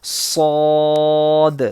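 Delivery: slow heavy صاد with a whistling s; said bold with whistle. Shown as a demonstration. Teacher shows students a heavy whistling letter, slow. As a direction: draw صاد out thick and hissing. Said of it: "bold پڑھیں گے".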